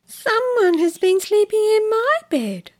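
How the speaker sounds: mother bear, pitched well up; mama bear voice. Acted. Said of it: "said the middle-sized Mama Bear".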